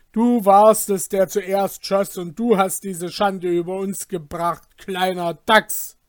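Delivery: loud, biting accusation; angry shout. Acted. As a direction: hurl the blame at him. Brothers quarrel, accusation.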